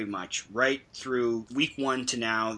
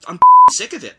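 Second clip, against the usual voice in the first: bellowed much higher and louder; fed-up shout; 19 dB louder than usual. Real, not acted. Fed up with referees, telling the listeners, fed-up shout. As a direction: let the frustration burst out. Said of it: "I was so angry at this".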